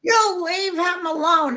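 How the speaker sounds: imitating her younger self shouting down bullies; retold scream. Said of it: "I started screaming".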